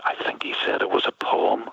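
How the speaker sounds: whispered reply. Retold or imitated. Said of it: "he looked at me kind of and said".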